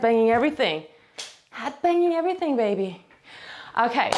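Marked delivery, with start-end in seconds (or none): 1.5-3.0 higher, quick pet talk to the cat in heat; cooing to the cat. Real, not acted. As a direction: coo it at Tinky. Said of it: "said again to the cat".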